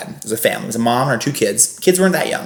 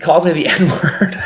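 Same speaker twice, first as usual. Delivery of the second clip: ordinary delivery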